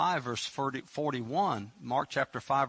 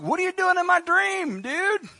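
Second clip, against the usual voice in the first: 1 mock confused; 6 dB louder than usual. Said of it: "I'd have been like".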